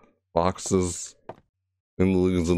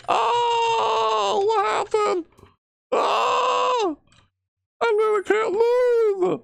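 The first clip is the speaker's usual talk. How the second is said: high, shouted frightened cries; panicked screaming; much slower than usual. Real, not acted. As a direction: cry out in real terror. Stuck in place, dreading jump scare.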